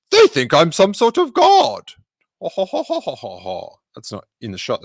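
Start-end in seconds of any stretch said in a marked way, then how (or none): 0.1-1.9 higher-pitched, astonished droid; amazed. Acted. Droid tells his friends of the worship, amazed. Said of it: "Three P O was amazed". 2.4-3.8 put-on chortle that tumbles down and fades; acted chuckle. Droid learns he is a god, hammed-up chuckle. Acts the droid's delighted laugh.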